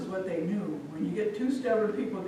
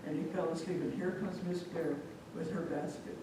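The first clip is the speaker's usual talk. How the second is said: plain reading voice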